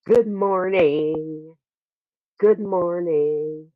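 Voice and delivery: drawn out, loud and high; slow drawn out. Shown as a demonstration. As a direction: stretch each word slowly. Mic check greeting.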